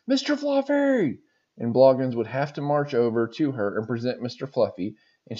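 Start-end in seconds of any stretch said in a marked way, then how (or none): 0.0-1.2 high, slow, drawn-out mock yell; yelled call. Sergeant calls for Mister Fluffy, a high drawn-out mock yell. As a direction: call it out across the barracks, stretched and high. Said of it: "she would yell out".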